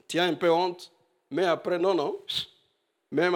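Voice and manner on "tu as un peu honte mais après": normal talking voice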